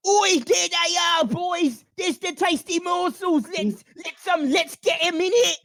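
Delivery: high, loud squeaky rat shout; rat battle cry. Acted. Radicus urging the rats at the goblins, squeaky shout. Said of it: "Radicus shout".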